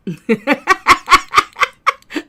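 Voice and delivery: rising delighted laugh; gleeful laugh. Real, not acted. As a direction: laugh out in triumph. Gleeful at catching Gigi.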